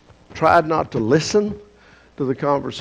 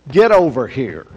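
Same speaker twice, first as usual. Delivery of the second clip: louder, commanding call; stern summons; 6 dB louder than usual. Acted. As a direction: order them over sharply. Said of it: "It was kind of".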